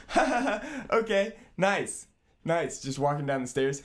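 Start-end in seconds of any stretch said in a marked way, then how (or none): 0.1-2.8 much higher, louder, slow nervous giggle; girlfriend's nervous laugh. Retold or imitated. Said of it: "she's just like".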